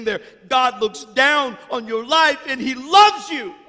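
loud, high, shouting sermon climax; building fiery sermon, shouting God's plea; preaching at full force. Acted. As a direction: preach it to the rafters.

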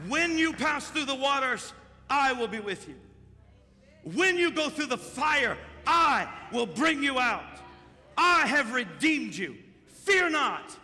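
slow, loud scripture proclamation; proclaiming scripture. Acted. Pastor declaring God's promise to John, slow and loud. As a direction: declare each promise slowly.

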